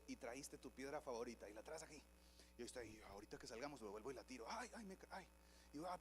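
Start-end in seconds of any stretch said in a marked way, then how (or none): 4.5-5.3 hammed-up "ay" while tripping; mock fall. Churchgoer stumbling over his own stone, mock cry of pain. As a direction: pretend to trip and cry out softly.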